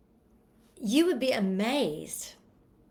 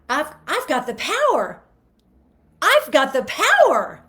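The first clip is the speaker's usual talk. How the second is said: bright, raised, joyful declaration; happy; 6 semitones higher, 8 dB louder than usual. Shown as a demonstration. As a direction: say it like you mean it happily. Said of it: "Do you see how happy that sounds?".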